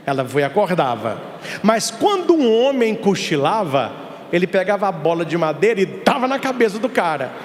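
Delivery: normal talking voice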